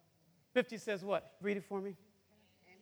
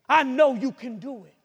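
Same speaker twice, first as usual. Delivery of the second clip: normal talking voice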